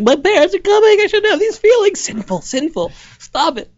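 very high, squeaky fretting; comic teen guilt in falsetto; mock guilty panic. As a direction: scold yourself in a hammed-up frightened squeal. Acts guilty teen watching music video.

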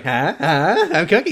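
imitated jazzed. Voicing Grace showing off her nickname, jazzed. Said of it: "so fucking jazzed about this nickname".

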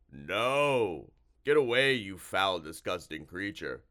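loud, revolted creature cry; loud monster panic, easing off; horrified monster. A narrator's reading. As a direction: recoil and shout it as a disgusted monster. Monster recoils in disgust.

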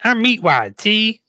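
small high voice played for laughs; Meatwad. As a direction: joke cartoon voice, pitched high. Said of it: "answers in a small voice".

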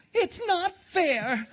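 put-on very high, slow child's whine; crying out petulantly. A narrator's reading. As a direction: wail it like a spoilt boy. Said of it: "cried the Grand Duke".

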